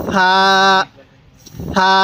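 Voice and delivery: gentle th opening a long সা; soft pronunciation. Shown as a demonstration. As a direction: say সা with a soft, lisped th. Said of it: "সাটা নরম করে".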